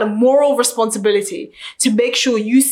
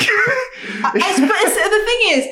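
laughing; 12 semitones higher, much slower than usual. Real, not acted. Guest laughing at host's joke.

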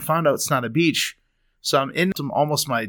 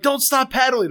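retold panicked plea; 11 semitones higher than usual. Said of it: "and I'm like".

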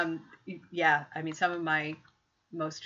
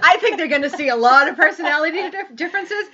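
laughing loudly while talking, pitched up; real laughter, louder and higher; 10 semitones higher, 14 dB louder than usual. Real, not acted. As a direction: keep the shared laugh going. Laughing along with her friend.

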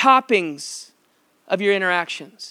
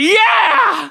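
triumphant yell; 14 semitones higher, 4 dB louder than usual. Retold or imitated. Gleeful older sibling triumph.

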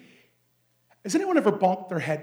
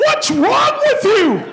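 slow, high, loud panicked question; much higher shouted alarm; 11 dB louder, much slower than usual. Retold or imitated. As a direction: play the freaked-out assistant. Assistant freaking out.

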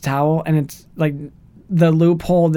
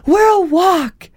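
high, mock outburst; hammed-up retold yell; imitated raised voice; 14 semitones higher, 4 dB louder than usual. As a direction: raise your voice like an upset movie heroine. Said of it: "Liv Tyler raises her voice".

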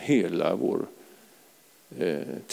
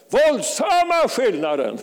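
high, loud preacher's yell breaking into a laugh; exultant pulpit shout; real shout; 12 semitones higher, 5 dB louder than usual. Real, not acted. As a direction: shout it with full force, then laugh. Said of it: "jag ber inte om ursäkt att jag skriker".

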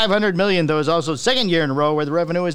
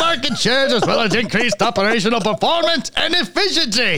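higher, hammed-up German accent; 4 semitones higher than usual. Co-host keeps reading the statement in a mock German accent. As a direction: read it like a stiff German executive. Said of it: "He sounds German".

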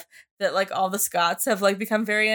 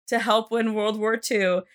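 ordinary talk

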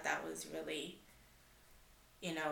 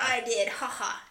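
louder, slow teasing ha-ha; mock gloating; 5 semitones higher, 7 dB louder than usual. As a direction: rub it in, playful. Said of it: "I wanted to tell her".

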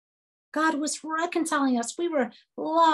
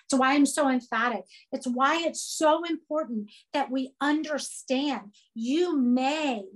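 ordinary talk, nothing marked

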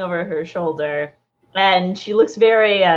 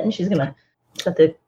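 usual speaking voice

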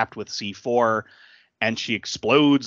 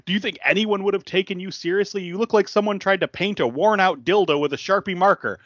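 mock sneering insult; 8 semitones higher than usual. Insult line retold.

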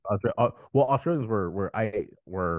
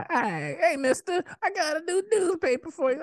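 very high put-on newsboy voice; squeaky cartoon paperboy hawking papers. Friend plays an old-time paperboy, high squeaky newsboy. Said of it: "talk like that".

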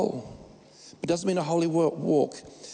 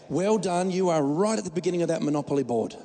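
usual speaking voice

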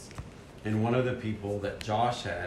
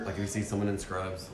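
ordinary delivery

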